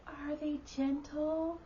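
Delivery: put-on high, soft, slow child voice; small, drawn-out little-kid question; cute little boy. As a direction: ask it sweetly like a tiny boy. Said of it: "super cute when he was little".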